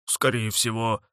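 slow hurt dragon voice; sulky dragon. Acted. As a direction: complain like a wounded dragon. Dragon insists he is ill.

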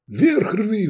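high barked order; commanding bark. A narrator's reading. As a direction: push the pilot with a loud command. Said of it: "рявкнул командирским голосом".

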